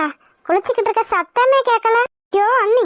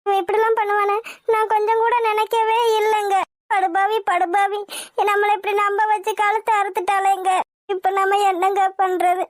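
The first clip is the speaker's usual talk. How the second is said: crying. Acted. Mother weeps to husband over runaway daughter, crying. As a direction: lament through sobs, drawing the words out. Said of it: "அழுகாத, அழுகாதம்மா".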